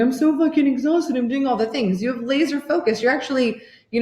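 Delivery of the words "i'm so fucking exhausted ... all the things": higher, whiny mock complaint; mock exhausted. Mocking a stressed woman flooring it in neutral, whiny. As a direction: whine like a burnt-out striver.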